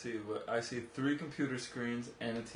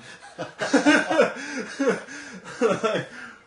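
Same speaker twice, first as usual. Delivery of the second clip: long, loud, high-pitched laugh; real, sustained belly laugh; hearty laugh; 13 semitones higher, 9 dB louder than usual. Real, not acted. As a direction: burst out laughing at your own admission. Laughs at own admission.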